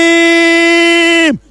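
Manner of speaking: very slow stretched shout of the name; elongated Ibrahim; drawn-out name call. Real, not acted. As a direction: hold the name like a goal call. Penalty scored, name called out.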